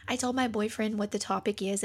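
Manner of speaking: ordinary talk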